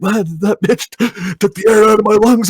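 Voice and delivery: high, loud breathless complaint; can't catch breath. Retold or imitated. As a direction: act out struggling for air after a nightmare. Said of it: "you were breathing like you couldn't breathe".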